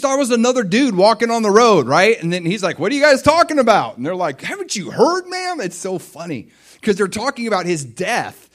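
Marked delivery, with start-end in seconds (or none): 2.8-4.0 high, loud, quick question; chummy butting in. Acted. Stranger joining two walkers on the road, breezily inquisitive. 4.4-5.6 high-pitched disbelieving question; incredulous. Acted. Disciples to a stranger on the road, incredulous.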